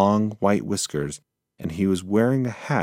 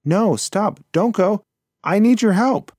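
high, loud pleading shout from the little mouse; desperate yell; 13 semitones higher, 7 dB louder than usual. Acted. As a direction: call out for help, louder each word. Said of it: "Hansa yelled out again".

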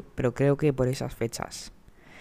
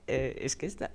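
ordinary talk, nothing marked

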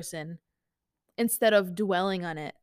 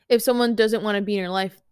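ordinary talk

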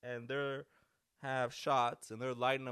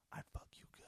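whisper; 14 dB quieter, much faster than usual. Pretend. Menacing comeback in imagined confrontation.

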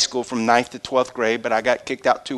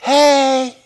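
high, sharp little hey; a quick high call; mock hey; 11 semitones higher, 5 dB louder than usual. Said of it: "he didn't go".

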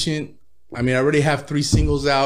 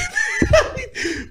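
high squealing laugh; real laugh, way up high; high laughing burst; burst of laughter. Real, not acted. Laughing at the story.

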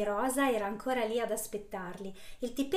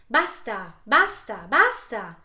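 building louder furious yells; furious shouting; 11 dB louder, much slower than usual. Acted. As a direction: hammer each basta harder. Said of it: "ripeteva infuriata la donna".

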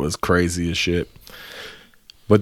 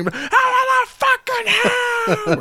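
screaming demand. Retold or imitated. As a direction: scream it like a man storming into the club. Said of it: "just screaming as soon as he got in there".

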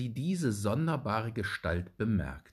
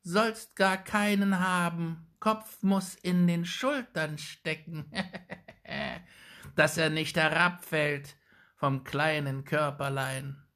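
put-on high, sly old hag voice; muttering witch; 8 semitones higher than usual. A narrator's reading. Old witch slyly asking the boy about thin necks, high hag voice. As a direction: needle the boy like a crone. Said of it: "murmelte die Alte".

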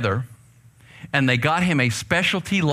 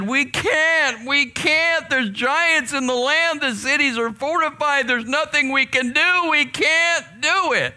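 fearful whining spies; 11 semitones higher than usual. Acted. The ten spies telling Israel they cannot, high and whining. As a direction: whine like the frightened spies.

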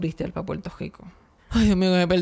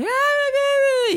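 very high screechy outburst, mimicking; mock outraged shrieking; 4 dB louder than usual. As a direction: imitate a woman kicking up a screaming fuss. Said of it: "una gritería".

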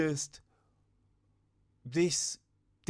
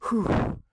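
relieved exhale; 8 semitones higher, 8 dB louder than usual. Retold or imitated. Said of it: "and then be like".

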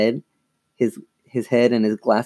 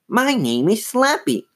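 high puppet voice; Slappy; 11 semitones higher than usual. A narrator's reading. Dummy introduces himself to the sisters, high squeaky voice. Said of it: "Lindsay made the dummy say".

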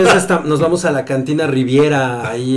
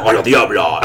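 deep voice; 7 semitones lower than usual. Pretend. Low voice ordering dish.

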